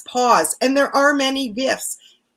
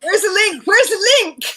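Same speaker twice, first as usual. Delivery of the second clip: high, urgent repeated question; mock frantic asking; 8 semitones higher, faster than usual. Acting out the hunt for the live link, high and frantic. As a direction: fire it off high, as if hunting frantically.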